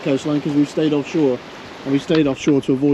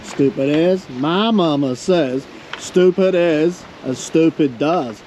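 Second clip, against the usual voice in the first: slow, drawn-out Southern drawl; Southern American accent; 2 semitones higher, much slower than usual. Acted. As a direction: stretch it out like a Southern movie quote. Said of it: "say it with the accent".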